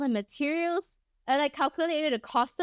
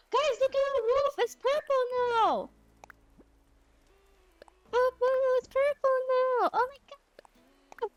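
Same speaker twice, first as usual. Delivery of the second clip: high-pitched gasping squeal; amazed excitement; 13 semitones higher than usual. Real, not acted. Streamer showing viewers the purple roof, high thrilled squeal.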